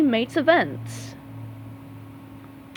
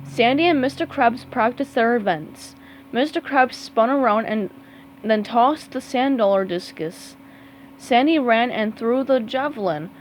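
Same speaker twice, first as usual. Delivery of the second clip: plain reading voice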